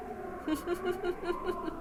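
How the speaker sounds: soft eerie laugh. Acted. Sinister laugh at call end.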